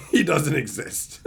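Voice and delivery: amused, breaking into laughter; laughing at your own joke. Real, not acted. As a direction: say it through a laugh, then crack up.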